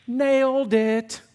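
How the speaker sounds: high, loud, drawn-out self-congratulation; mock triumph. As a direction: stretch it out in joking victory. Joking triumphant thought, then denied.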